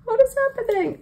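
puzzled, looking around at everyone; confused surprise. Retold or imitated. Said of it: "And I was like".

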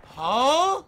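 loud "huh" swooping sharply up; high incredulous question; baffled outburst. Real, not acted. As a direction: blurt it in total disbelief. Baffled at game.

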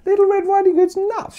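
high, loud exclaim of disbelief; mock outrage. Real, not acted. Teacher mocking the fairy tale, high and loud. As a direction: squeal it like it's outrageous.

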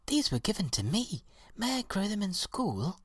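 put-on high-pitched little girl voice; high, polite child's question. A narrator's reading. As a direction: ask like a hopeful child, pitched right up. Little girl asks her teacher.

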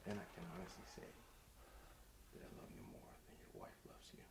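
low, slow unvoiced whisper; creepy intimate confession.

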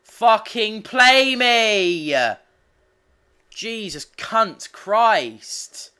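slow, drawn-out high furious yell; angry shout. Real, not acted. Frustrated gamer screaming for an opponent to play, slow furious yell. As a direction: bellow it, stretching every word. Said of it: "I'm getting angry now".

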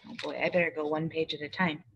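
ordinary delivery

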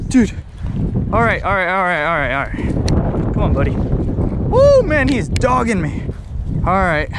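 tense then whooping high; straining to land the fish; fighting a fish. Real, not acted.

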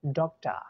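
"डॉक्टर" as DOK-tuh, R dropped; schwa ending. Shown as a demonstration. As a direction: teach "डॉक्टर" with a falling, open uh ending. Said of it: "डॉग नहीं".

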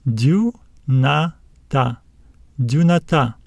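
"Dunata" slowly split into du, na, ta; slow teacher's demo of "Dunata", rising at the end; syllable by syllable. Shown as a demonstration. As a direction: sound out "Dunata" in three separate syllables, stressing the last. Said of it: "Let's read it".

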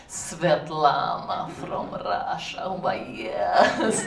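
put-on slow, low Russian-accented drawl. Comic introducing her Russian neighbour, Russian accent.

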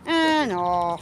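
lightly put-on low voice; Oh My Gosh. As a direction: mimic the catchphrase, low and stretched. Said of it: "Oh My Gosh T V would say".

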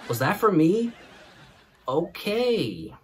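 stretched, offended disbelief; indignant retort. Retold or imitated. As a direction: pitch it up in mock offense.

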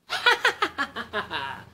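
high gleeful giggle; a little triumphant laugh; mouse giggle. Acted. Mouse laughs at the fleeing snake.

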